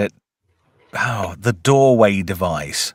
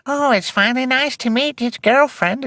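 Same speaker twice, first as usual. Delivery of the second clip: high, sweet mock mum; 14 semitones higher than usual. Mother greeting her son's supposed girlfriend, high mock voice. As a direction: chirp it like a doting mother. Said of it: "his mum comes down and she's like".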